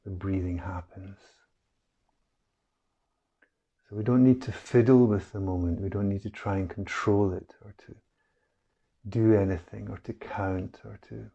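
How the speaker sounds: calm, unhurried meditation guide, long pauses between phrases; guided meditation. Real, not acted. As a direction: ease listeners into stillness, letting each phrase settle. Leads a breath meditation.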